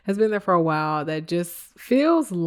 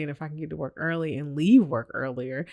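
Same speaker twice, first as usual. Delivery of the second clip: ordinary talk, nothing marked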